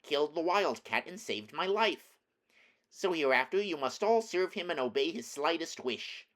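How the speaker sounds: high-pitched royal mouse voice; commanding mouse queen. A narrator's reading. Mouse queen orders her mice to serve their rescuer, high commanding voice.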